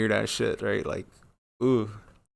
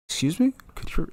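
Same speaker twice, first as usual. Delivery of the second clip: high, disbelieving "excuse me?"; incredulous; 6 semitones higher than usual. Retold or imitated. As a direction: replay the shock of her invite. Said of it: "And I was just like".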